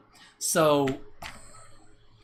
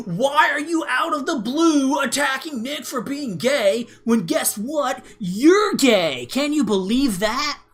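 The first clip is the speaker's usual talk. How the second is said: much higher, louder, slow mock outrage; mocking indignant troll; 9 semitones higher, 5 dB louder, slower than usual. Streamer mocks a groyper commenter in a high, loud, outraged voice. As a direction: hams up a sneering gotcha voice, high and loud.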